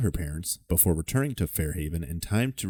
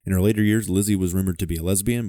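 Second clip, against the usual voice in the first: ordinary delivery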